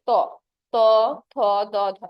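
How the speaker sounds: ত ত থ দ ধ each said alone, tongue on teeth; teacher showing dental sounds ত থ দ ধ; dental consonant demo. Shown as a demonstration. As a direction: sound out ত থ দ ধ one by one. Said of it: "তুমি উচ্চারণ করো ত".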